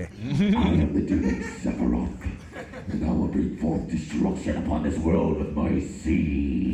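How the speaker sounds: deep, slow demonic boom; booming demon lord. Pretend. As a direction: announce yourself as a dark god. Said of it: "You, you must be a demon".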